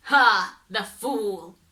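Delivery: loud mocking Ha, then a sneer; the devil scoffs at the ferryman; scornful devil. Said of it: "answered the devil".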